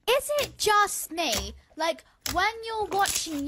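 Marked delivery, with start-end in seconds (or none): none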